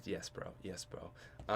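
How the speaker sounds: breathy whispered yes bro; whispered aside. Real, not acted. Thanking the mods in chat, a soft whispered aside. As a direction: murmur it under your breath.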